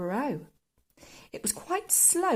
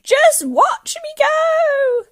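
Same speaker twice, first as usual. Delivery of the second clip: slow, high, triumphant yell; screechy old woman showing off; calling down; 12 dB louder, much slower than usual. A narrator's reading. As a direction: crow it loudly, stretching each word. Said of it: "she called down to George".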